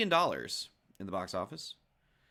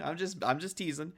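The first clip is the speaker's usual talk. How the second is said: ordinary talk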